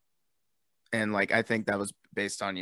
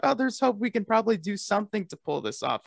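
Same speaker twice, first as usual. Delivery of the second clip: hopeful; 12 semitones higher, 6 dB louder than usual. Retold or imitated. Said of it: "we thought".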